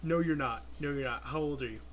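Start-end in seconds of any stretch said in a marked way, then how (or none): none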